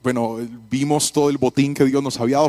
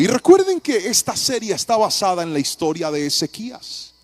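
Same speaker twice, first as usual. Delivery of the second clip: ordinary talk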